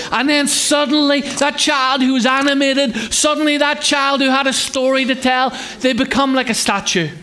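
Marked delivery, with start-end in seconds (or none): none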